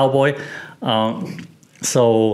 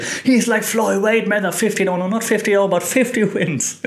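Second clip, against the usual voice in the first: celebrating commentator; 8 semitones higher than usual. Retold or imitated. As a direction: imitate an elated commentator, pitched well up. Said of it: "like, celebrating it".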